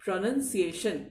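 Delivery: प्रोनंसिएशन said with short "non" syllable; प्रोनंसिएशन, no "noun" sound inside; correct pronunciation. Shown as a demonstration. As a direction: say प्रोनंसिएशन as pro-nun-si-ay-shun. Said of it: "क्या है?".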